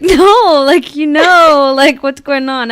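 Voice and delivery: hammed-up outraged parent; mock indignant parent. Parent reacting to toddler's sass, hammed-up indignant scold.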